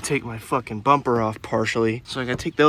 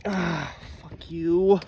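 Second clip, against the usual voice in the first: strained curse; 7 semitones higher, much slower than usual. Real, not acted. Straining again on the part.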